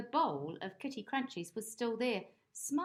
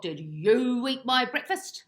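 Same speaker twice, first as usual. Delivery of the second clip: loud accusing; 5 semitones higher, 11 dB louder than usual. Acted. Bob loudly accusing old dog.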